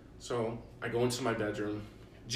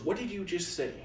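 retold angry demand, pitched up high; pissed-off dad; 8 semitones higher than usual. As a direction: imitate an incensed dad demanding answers. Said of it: "So pissed off".

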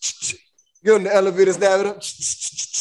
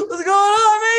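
high, wailing mock alarm; retold alarmed question; 10 semitones higher, 3 dB louder than usual. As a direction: ask in high-pitched disbelief.

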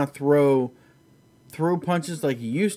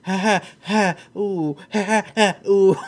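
mock painful laughter with winces; pained laugh; 6 semitones higher, 4 dB louder than usual. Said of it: "he can't laugh".

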